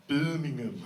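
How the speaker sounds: attempted Brummie accent on "Birmingham"; mock Birmingham accent; local Birmingham accent. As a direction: say "Birmingham" as Brummie locals would, vowels bent. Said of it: "or as they call it".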